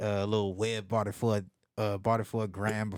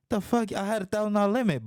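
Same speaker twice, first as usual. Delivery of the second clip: high, quick incredulous objection; acted-out indignation, pitched way up; retold indignant protest; 12 semitones higher, 3 dB louder than usual. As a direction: argue back in high-pitched disbelief. Retold argument with card company.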